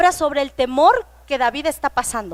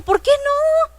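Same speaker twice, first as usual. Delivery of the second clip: astonished question; 11 semitones higher, much slower than usual. Retold or imitated. Teacher asking the children why not, astonished and high. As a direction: sound truly taken aback. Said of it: "Les dije".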